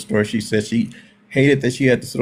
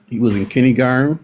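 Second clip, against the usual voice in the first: ordinary delivery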